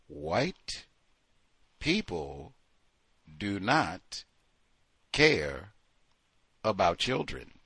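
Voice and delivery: low, soft, word by word; deliberate verdict. Real, not acted. Commenter concluding on social services, deliberate and hushed. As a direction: spell it out slowly with long pauses. Pointed, spelled-out verdict after ranting.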